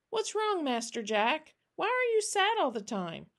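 put-on high child voice, asking with concern; a small child worried for an old friend; high, searching little-girl question. Child worried about sad old man.

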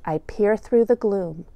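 plain reading voice

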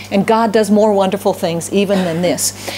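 ordinary talk, nothing marked

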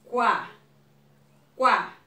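Quá said firmly with a strong w glide; strong syllable. Shown as a demonstration. Teacher showing children the strong sound, Quá said firmly. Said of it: "o som mais forte é a primeira sílaba".

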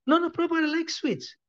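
high-pitched, eager voice of a disciple; protesting disciple. Retold or imitated. Disciple answering his guru's question, high-pitched protest.

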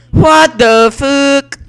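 loud, high, slowly drawn-out cry; outraged shout. Real, not acted. Gamer outraged after dying in game, slow loud yell. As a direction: yell it in disbelief.

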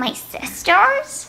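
high, slow cartoon girl voice; drawn-out little cartoon girl; Bubbles. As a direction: squeak it out slowly. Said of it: "your favorite Powerpuff Girl".

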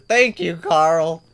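high, loud cheer of thanks. Real, not acted. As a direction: shout the thanks out happily. Gamer thanks Carl loudly.

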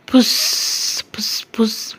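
breathy shushing noises; mock hushing. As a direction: hush like a kid sneaking away. Boy mimics whispered hushing.

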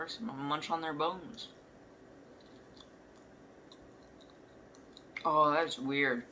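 chewing while talking; speaking between bites; mouth full of marshmallow. Real, not acted. Seen 1: holds marshmallow throughout, repeatedly bites it while talking.

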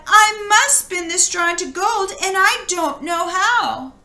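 high, pleading cry of despair; tearful girl. A narrator's reading. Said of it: "the girl cried".

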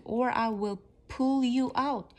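mildly growled threat; a touch of growl on the warning. A narrator's reading. Guinea pig threatens to drag Floppy out, mildly growled. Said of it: "he growled".

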